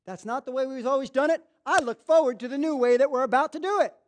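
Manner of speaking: eager embracer of the new. Shown as a demonstration. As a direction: say it like someone keen for change. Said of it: "In other words".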